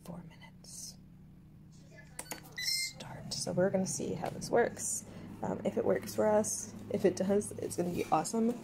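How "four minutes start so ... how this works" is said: whispering. Real, not acted. Quiet while the machine starts.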